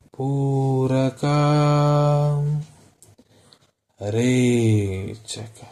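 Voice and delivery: very slow, long-held vowels on "ಪೂರಕ" and "ರೇಚಕ"; drawn-out breathing cues. Shown as a demonstration. Yoga teacher timing inhale and exhale, slow chant-like cues. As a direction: stretch each word over a whole breath, long pauses between.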